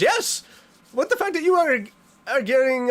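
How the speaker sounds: high, loud excited yes; excited squeal. Real, not acted. Streamer cheering for new gift members, high excited. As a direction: burst out with glee.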